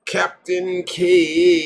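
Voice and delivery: loud, slow, high call of Captain Caveman; drawn-out cartoon shout. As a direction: bellow the name like a cartoon hero.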